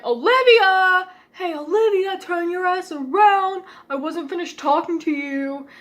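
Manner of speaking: bully yelling her name; mock yell. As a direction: holler it like a bully. Bullies yelling at her in class.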